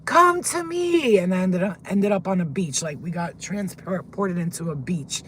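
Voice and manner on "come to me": high, loud, drawn-out call; screamed summons. Retold or imitated. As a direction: scream a command up to the heavens. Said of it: "I screamed out".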